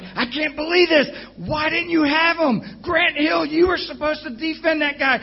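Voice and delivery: frantic fan ranting at the TV; retold yelling at TV. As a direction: blow up at the players on TV. Yelling at the TV over the game.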